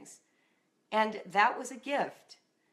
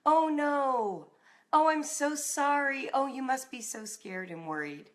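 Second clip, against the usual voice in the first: tender; 7 semitones higher than usual. Retold or imitated. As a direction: soothe a scared daughter gently. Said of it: "That was my dad's reaction".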